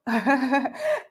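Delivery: short chuckle; a brief real laugh. Real, not acted. Host amused by a viewer's message, chuckling.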